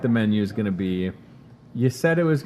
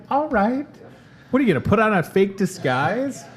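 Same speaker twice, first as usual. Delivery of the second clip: higher, mock-incredulous teasing; teasing mom; 9 semitones higher than usual. Son teasing mom about a disguise, higher mock disbelief. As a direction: poke fun at her in a higher voice. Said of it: "And I'm like".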